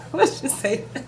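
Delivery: real laugh through the words. Real, not acted. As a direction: ask it while cracking up. Laughs at her own retelling.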